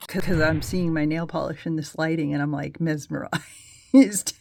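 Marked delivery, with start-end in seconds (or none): 2.8-4.3 drawn-out word, louder than usual; mesmerized. Acted. Vlogger entranced by her nail polish, mesmerized drawl. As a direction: stretch it out as if entranced.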